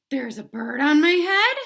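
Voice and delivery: surprised elephant voice; alarmed Gerald. Acted. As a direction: panic building up.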